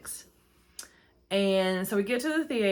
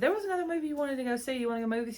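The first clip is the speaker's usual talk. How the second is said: high, fast, eager question; eager ask; 7 semitones higher, much faster than usual. Retold or imitated. Asking Hubby B to the movies, high quick eager. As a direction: pitch it brightly to hubby. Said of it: "I said".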